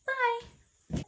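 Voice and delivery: high, bright sign-off call; cheery bye. Real, not acted. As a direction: sing out a cheerful goodbye.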